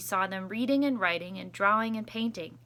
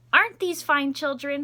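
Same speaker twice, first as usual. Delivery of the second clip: beaming, louder and higher; a principal delighted with his school; proud principal; 5 semitones higher, 8 dB louder than usual. Acted. Principal admiring his school, beaming and loud. Principal voiced in read story.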